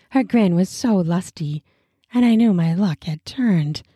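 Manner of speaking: ordinary delivery, nothing marked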